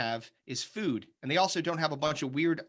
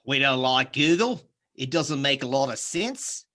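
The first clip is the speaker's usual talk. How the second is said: slow put-on Aussie voice, lifting at line ends; Australian accent; 4 dB louder, slower than usual. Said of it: "start talking like that".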